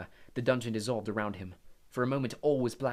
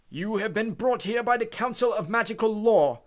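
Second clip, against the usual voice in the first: high, loud, slow and stern Crouch; stern judge voice, much louder; 11 semitones higher, 10 dB louder than usual. Acted. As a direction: pronounce the charge coldly. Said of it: "he said clearly".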